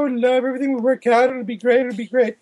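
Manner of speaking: naive gushing. Retold or imitated. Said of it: "Way too many people like".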